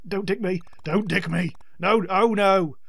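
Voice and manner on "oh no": ordinary delivery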